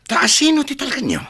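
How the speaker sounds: sharply high, indignant outburst; angry demand. Acted. Jealous son demanding whom his mother trusts, indignant. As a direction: fire the question as an accusation.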